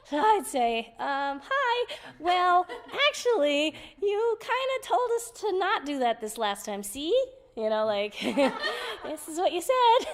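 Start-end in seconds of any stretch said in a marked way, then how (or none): 1.0-7.1 high, sheepish, hesitant voice; timid correction. Retold or imitated. 9.0-10.1 high, laughing reminder; half-laugh as she points it out; giggling. Retold or imitated. Showing him the notes.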